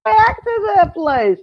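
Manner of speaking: bright, urgent high voice; high excited quote. Retold or imitated. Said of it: "if Joyce hadn't said".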